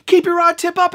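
high, excited coaching; imitating an excited guide; urgent fishing guide. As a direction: urge it out high and fast. Guide urging during the fight.

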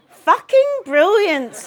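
loud, high, drawn-out exasperation; sarcastic exasperation. A narrator's reading. Daughter fed up with father's prying interest, sarcastic exasperation. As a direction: land each word slowly and loudly, with bite. Exasperated punchline to her list.

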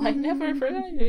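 high, loud, hurried denial; hammed-up protest. Simba protests to his ghost dad, hammed-up pleading. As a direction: protest in an exaggerated high rush.

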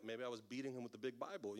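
ordinary talk

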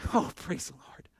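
soft, breathy exclamation of thanks; an imagined believer's near-whispered relief; hushed praise. Acted. Pastor voicing a grateful congregant's response, hushed praise.

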